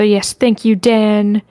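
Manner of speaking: ordinary delivery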